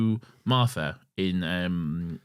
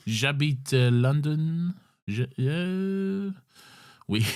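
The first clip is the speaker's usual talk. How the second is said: higher, slow hammed-up French accent; 6 semitones higher, much slower than usual. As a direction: stretch out euh and London in French style. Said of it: "you got to throw in the accent".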